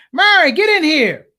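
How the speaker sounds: high, loud summons to the wife; calling out. Retold or imitated. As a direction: holler for her as an alarmed dad. Father calls his wife over.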